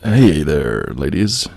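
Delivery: radio DJ voice. Pretend. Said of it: "You sound like a Saturday morning disc jockey".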